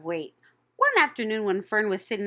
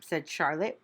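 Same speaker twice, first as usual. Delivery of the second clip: ordinary delivery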